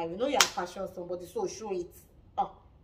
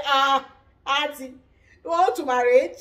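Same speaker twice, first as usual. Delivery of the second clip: teasing shout; 5 semitones higher, 13 dB louder than usual. Retold or imitated. Quoted cast member teasing the auntie.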